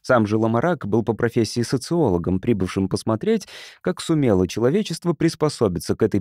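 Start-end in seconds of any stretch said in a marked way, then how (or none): none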